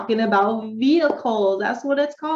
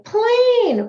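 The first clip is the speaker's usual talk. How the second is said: put-on child answering; 11 semitones higher, 6 dB louder than usual. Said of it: "And they could say".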